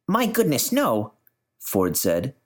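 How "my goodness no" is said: high, startled reassurance; a keen physicist brushing off fear; surprised scientist. Acted.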